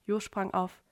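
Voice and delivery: usual speaking voice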